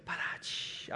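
unvoiced drum-and-cymbal punchline sting; mouthed rimshot. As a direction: imitate a comedy rimshot with the mouth. Imitated rimshot after the joke.

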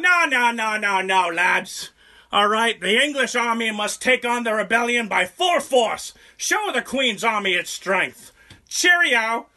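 high, loud put-on British accent; hammy British officer voice; mock posh English. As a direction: play a pompous English commander. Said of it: "English morons that are on the other side".

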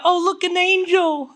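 high, put-on cooing awe; sweetly naive, pitched high; mock wonder. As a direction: coo it as a mock-delighted onlooker. Mocking a sweet reaction to angels.

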